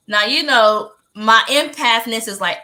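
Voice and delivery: normal talking voice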